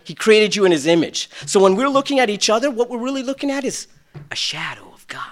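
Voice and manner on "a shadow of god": hushed whisper, much softer; a whispered shadow of God. Real, not acted. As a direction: breathe it like a secret. Hushed reverent point.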